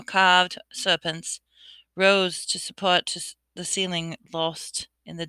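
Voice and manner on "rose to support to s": usual speaking voice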